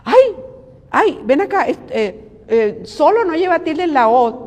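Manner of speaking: high, mocking busybody voice with sharp shrieks of "Ay"; catty gossip. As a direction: play a nosy envious woman fussing over an accent mark. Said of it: "Y empezaron a hablar".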